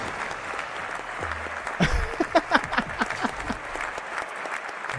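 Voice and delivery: long soft, high laughter; real quiet wheezing giggle; breathless laugh. Real, not acted. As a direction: laugh softly till out of air. Laughs at the bruh alerts.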